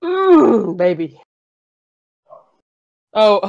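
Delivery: ordinary talk, nothing marked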